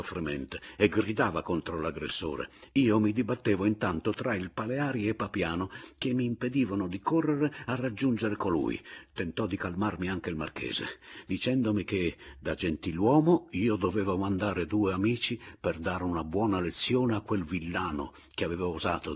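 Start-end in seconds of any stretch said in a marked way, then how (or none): none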